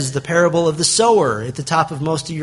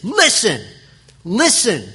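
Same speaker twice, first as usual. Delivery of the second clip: forceful, raised command to pay attention; higher-pitched, urgent "listen!"; emphatic; 5 semitones higher, slower than usual. Shown as a demonstration. Preacher showing how Jesus opened his parable, emphatic. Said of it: "It's emphatic".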